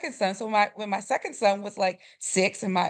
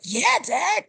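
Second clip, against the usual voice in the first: put-on high, keen little boy's answer; excited kid answering Dad; eager young son; 9 semitones higher, 4 dB louder than usual. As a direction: agree eagerly, pitched up like a child.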